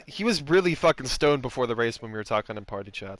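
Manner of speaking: normal talking voice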